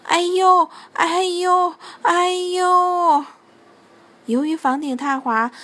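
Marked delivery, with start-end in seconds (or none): 0.1-3.3 high, drawn-out cries of ouch; crying out. A narrator's reading. Santa fallen from the roof, crying for help. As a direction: wail it louder and higher, sustained. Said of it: "圣诞老人的呼喊声".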